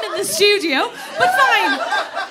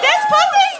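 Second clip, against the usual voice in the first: incredulous shriek; 11 semitones higher, 5 dB louder than usual. Real, not acted. Incredulous exclamation.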